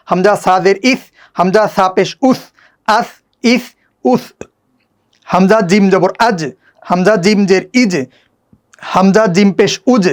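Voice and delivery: rhythmic, patterned letter-by-letter recitation; spelling drill recitation. Shown as a demonstration. Teacher reads the whole exercise through for learners, rhythmic drill recitation. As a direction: recite each syllable set in the same measured tune.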